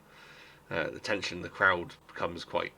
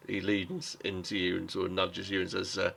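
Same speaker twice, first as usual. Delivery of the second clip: normal talking voice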